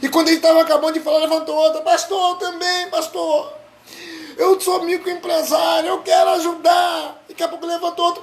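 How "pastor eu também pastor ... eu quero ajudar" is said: eager offer. Retold or imitated. Church member eagerly offering.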